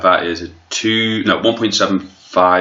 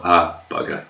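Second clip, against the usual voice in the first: Hugh Grant impression. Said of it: "when Hugh Grant says bugger in a film".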